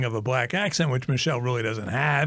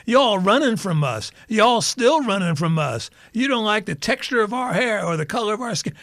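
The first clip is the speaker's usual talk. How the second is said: imitated drawl; 6 semitones higher than usual. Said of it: "This is what she's saying".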